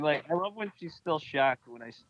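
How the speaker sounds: ordinary talk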